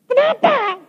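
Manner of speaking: very high mock-wailed question; retold tearful protest. Preacher re-enacts asking God why, tearful protest. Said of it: "nangis saya".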